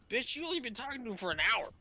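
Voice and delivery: high, fast disbelieving retort; incredulous outburst. Retold or imitated. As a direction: fire it off in disbelief. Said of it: "I'm like".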